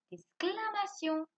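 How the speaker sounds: usual speaking voice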